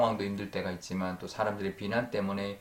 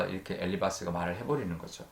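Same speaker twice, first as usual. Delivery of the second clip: ordinary delivery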